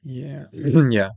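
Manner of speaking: hoarse, worn-out voice; losing voice. Real, not acted. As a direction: speak through a failing throat. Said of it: "sorry, I'm losing my voice here".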